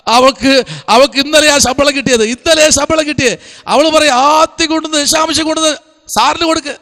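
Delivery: high, loud and excited; excited retelling. Retold or imitated. Preacher retells parents bringing daughter's first tithe, excited and high. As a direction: burst out with the good news.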